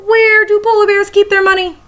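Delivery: teacher builds up the punchline question for her class; playful joke question. Shown as a demonstration. Teacher to her students, reading a joke, playfully high and loud. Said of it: "Time for another joke".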